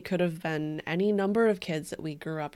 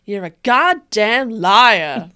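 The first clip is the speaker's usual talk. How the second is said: loud slow mock-angry yell; 12 dB louder, much slower than usual. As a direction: bellow it out slowly.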